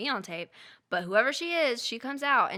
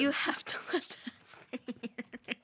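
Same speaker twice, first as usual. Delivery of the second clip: whisper; 3 semitones higher than usual. Real, not acted. S1 whispers about camera.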